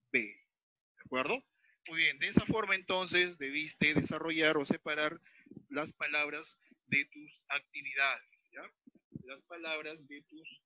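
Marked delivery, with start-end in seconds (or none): none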